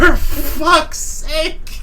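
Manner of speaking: loud, high, drawn-out exclamation; real shocked outburst. Real, not acted. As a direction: cry it out in stunned disbelief. Reacting to the punchline in disbelief.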